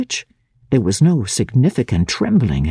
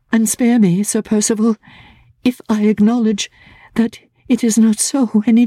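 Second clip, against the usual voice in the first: put-on higher, gentle young woman's voice; Laura's voice; 9 semitones higher than usual. A narrator's reading. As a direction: voice Laura, trembling with resolve.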